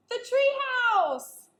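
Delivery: gasp, then a slow, loud, high cry of relief; joyful gasp. Acted. Annie seeing the treehouse high above, slow loud gasp of joy. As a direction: gasp and sing out the discovery.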